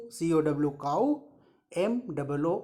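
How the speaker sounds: normal talking voice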